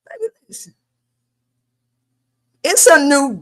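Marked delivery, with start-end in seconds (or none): 0.1-0.7 high, hushed whisper; whispered aside. Real, not acted. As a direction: drop to an emphatic whisper. Emphatic aside in a pep talk.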